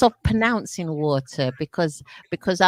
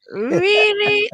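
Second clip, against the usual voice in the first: high, rising mock disbelief; incredulous reaction; 13 semitones higher, 4 dB louder than usual. Host recalls reacting to Londoners' speech, incredulous. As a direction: stretch it upward in exaggerated doubt. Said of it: "It's like".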